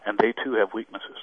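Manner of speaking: ordinary talk, nothing marked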